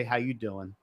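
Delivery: low, hushed ladies'-man purr; smooth pickup line. Retold or imitated. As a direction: play the slick charmer leaning in close. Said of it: "he'll rub it across their cheek and say".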